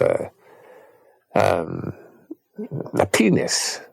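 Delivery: ordinary delivery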